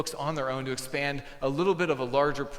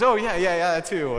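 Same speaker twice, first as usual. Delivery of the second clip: put-on eager kid agreeing; 6 semitones higher, 11 dB louder than usual.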